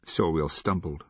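plain reading voice

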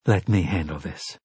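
soft firm inner voice; quiet. A narrator's reading. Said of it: "To Mia she said".

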